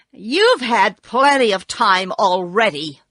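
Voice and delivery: loud, indignant protest; angry. A narrator's reading. Tin Woodman objecting to Oz's delay, angrily. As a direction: object loudly, with restrained anger. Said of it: "said the Tin Woodman angrily".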